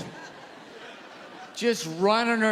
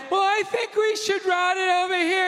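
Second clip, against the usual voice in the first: mock plumber's wife; 8 semitones higher than usual.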